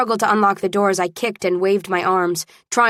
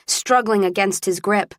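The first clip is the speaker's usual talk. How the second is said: ordinary delivery, nothing marked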